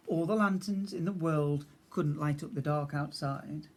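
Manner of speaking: ordinary delivery, nothing marked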